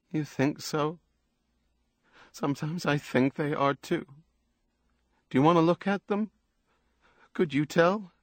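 a touch of tearful uncertainty; lightly voiced tearful woman. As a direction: lightly voice an upset woman seeking reassurance.

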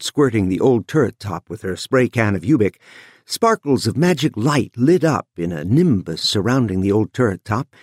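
ordinary delivery, nothing marked

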